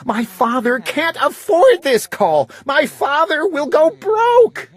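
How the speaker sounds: high, pleading voice; a worried child's high plea; upset little sister. A narrator's reading. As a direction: beg him to stop, high and upset. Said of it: "my little sister screamed".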